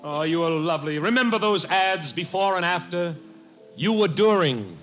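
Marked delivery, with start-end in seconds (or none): none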